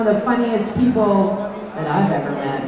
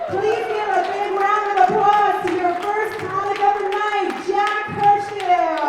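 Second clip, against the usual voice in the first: announcer introduction; 12 semitones higher than usual. Real, not acted. Host bringing on the night's first comic, big announcer call. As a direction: build up to the performer's name, stretching it out.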